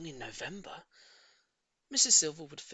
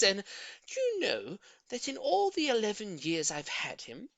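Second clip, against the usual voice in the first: higher, rising to indignant; fretting old lady; 7 semitones higher, 5 dB louder than usual. A narrator's reading. Old lady fretting to neighbour about tortoise weight, rising indignation. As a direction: build up to a shocked complaint. Mrs Silver complains.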